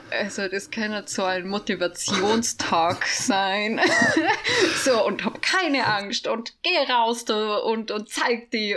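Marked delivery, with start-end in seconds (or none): 3.9-4.8 high laugh. Real, not acted. Laughs at AI advice.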